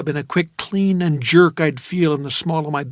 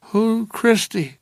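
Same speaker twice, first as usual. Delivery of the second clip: slow, high and puzzled; groggy bewildered question; dazed woman; 6 semitones higher, slower than usual. Acted. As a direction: ask it half-asleep.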